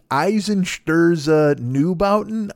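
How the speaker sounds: "Eisen Sturza Neubauten" said slowly, syllable by syllable, trying to get it right; careful name attempt. Shown as a demonstration. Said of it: "I think that's what it is".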